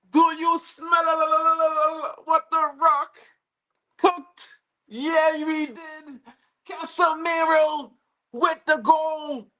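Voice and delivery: high, slow, stretched taunt; hyped shout. Real, not acted. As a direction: savour every word like a victory lap. Hyped after the goal.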